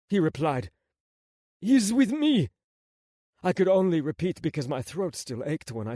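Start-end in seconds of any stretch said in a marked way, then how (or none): 1.6-2.5 raised, loud plea; insistent protest. Acted. Prince repeating himself to gunman, urgent insistence.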